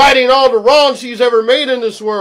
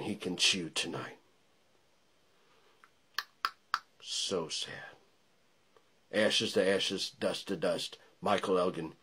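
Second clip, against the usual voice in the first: menacing hush; 12 semitones lower, 12 dB quieter than usual. Acted. Promo ends in hushed threat.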